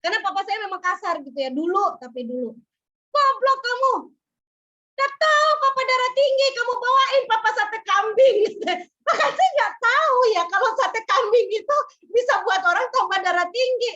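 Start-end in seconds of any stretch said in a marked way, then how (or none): 3.1-8.4 higher, louder imitated scolding; father scolding his daughter for bringing goat satay; furious father. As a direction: voice the father's harsh rebuke. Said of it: "marah-marah sejadi-jadinya".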